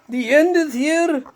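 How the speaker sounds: high, loud squealing fish voice; screaming fish. A narrator's reading. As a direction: shriek it like a panicked talking fish. Fish repeats its warning.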